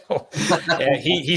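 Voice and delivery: laughing into the reply; laugh, then start talking. Real, not acted. Laughing at co-host's joke.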